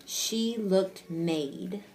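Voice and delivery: very slow, "made" held with long A; deliberate rereading. Shown as a demonstration. Teacher rereading pupil's mistake to check it, deliberate. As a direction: read word by word, stretching "made". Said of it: "I was gonna reread this part".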